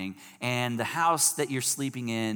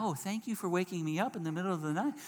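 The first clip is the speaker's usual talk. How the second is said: softer, higher sugary thanks; sweetly overdone, insincere thank-you; mock gratitude; 6 semitones higher, 7 dB quieter, faster than usual. Sleeper thanking whoever woke them at night, mock gratitude.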